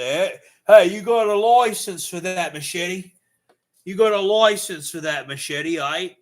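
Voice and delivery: loud, high mock Australian accent. Streamer as an Australian challenging a machete owner, loud Australian accent. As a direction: call out in a put-on Australian accent. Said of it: "they'll go say".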